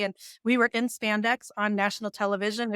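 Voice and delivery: usual speaking voice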